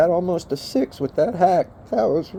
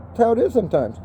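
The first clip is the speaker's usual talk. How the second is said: normal talking voice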